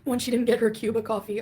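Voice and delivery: normal talking voice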